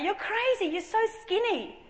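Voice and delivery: high-pitched mock disbelief; incredulous exclamation. People tell the anorexic girl, incredulous. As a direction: pitch it up as if astonished.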